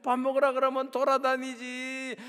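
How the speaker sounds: ordinary delivery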